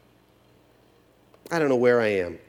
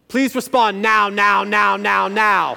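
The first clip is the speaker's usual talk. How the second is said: put-on demanding kid; 4 semitones higher, 7 dB louder, slower than usual. Daughter's demanding text read out.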